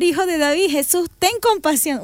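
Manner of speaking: high, restrained pleading cry; half-hearted shout. Acted. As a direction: cry out for mercy without fully letting go. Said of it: "si te animás a gritar".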